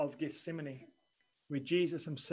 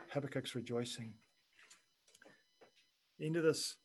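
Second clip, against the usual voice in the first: ordinary delivery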